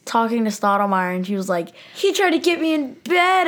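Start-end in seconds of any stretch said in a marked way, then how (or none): 2.0-3.5 high, dramatic mock accusation; dramatic victim. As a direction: play the wronged woman, hammed-up and much higher. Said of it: "being all dramatic and stuff".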